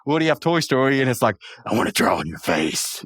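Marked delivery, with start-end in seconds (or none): 1.6-3.0 low pull-string doll voice; creepy talking doll. Retold or imitated. Podcaster imitates the pull-string doll, low creepy voice. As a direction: drop low like a creepy toy. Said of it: "when he said that in that voice".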